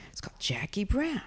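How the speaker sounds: breathless whispered disbelief; hushed, stunned whisper; awed whisper. Retold or imitated. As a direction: whisper it as if you can't believe it. Awestruck at reading the title.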